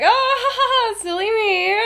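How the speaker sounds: high, put-on "silly me"; badly faked breezy laughter; fake laugh. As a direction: play it off with a phony high giggle. Lily fakes an excuse.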